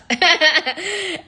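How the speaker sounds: a burst of high laughter. Real, not acted. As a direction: laugh at your own boldness. Laugh after retold defiance.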